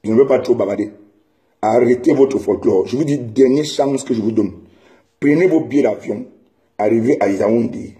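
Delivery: ordinary talk, nothing marked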